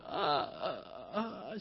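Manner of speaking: imitated wailing sobs; hammed-up bawling "ah, ah"; mock crying. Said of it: "She's crying, going".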